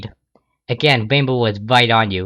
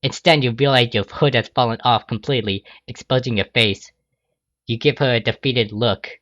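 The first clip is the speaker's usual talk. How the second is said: usual speaking voice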